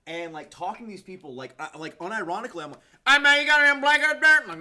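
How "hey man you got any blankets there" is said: put-on hollers Southern accent, much higher and louder; drunk Boomhauer Southern accent. Asking holler folks for blankets in a thick Southern accent. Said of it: "you're talking like that".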